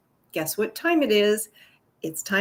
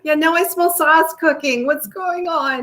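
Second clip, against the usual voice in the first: Italian accent, higher, louder, then slowing; 6 semitones higher, 4 dB louder than usual. Real, not acted. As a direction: ham up the Italian accent as if smelling sauce cooking. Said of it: "using an Italian accent".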